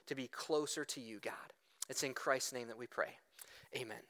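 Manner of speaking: low, soft praying voice; hushed, low pastoral prayer; earnest prayer. Real, not acted. Leads the congregation in prayer.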